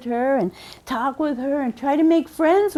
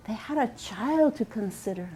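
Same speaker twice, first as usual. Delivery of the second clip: softer echo; 3 semitones lower, 9 dB quieter than usual. A narrator's reading. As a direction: repeat it much softer, as an echo. Echoed repeat, much softer.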